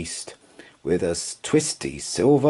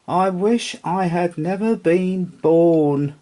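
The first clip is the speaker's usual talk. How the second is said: high, forlorn little horse; sad horse voice; 9 semitones higher, 3 dB louder than usual. Acted. Lonely rocking horse in the attic, high and forlorn. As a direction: say it sadly, high.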